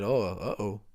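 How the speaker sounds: mock Mexican accent; light put-on Mexican accent. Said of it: "you kinda did that in a Mexican accent".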